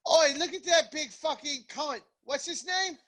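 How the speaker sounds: high, slurred Cockney-style cry; mock British; mock British yob. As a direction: bellow it like a rough British bloke. Mock British Pokemon banter.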